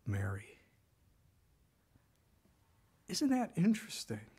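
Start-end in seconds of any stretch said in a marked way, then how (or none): 0.1-0.6 whispered tenderly, softer and lower; whispered name. Acted. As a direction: breathe the name Mary gently. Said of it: "One word".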